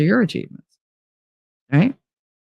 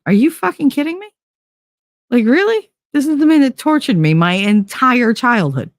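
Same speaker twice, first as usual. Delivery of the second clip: much higher, fast disbelieving outburst; can't believe what she's hearing; incredulous outrage; 9 semitones higher than usual. Retold or imitated. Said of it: "I'm sitting there going".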